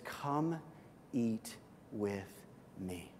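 soft, slow gentle call; a hushed welcoming come eat with me; tender invitation. Retold or imitated. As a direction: invite them in softly. Jesus voiced tenderly.

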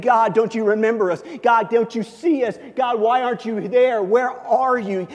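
loud, high-pitched plea; crying out to God. Acted. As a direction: cry out as a desperate people questioning God. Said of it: "they're crying out to God".

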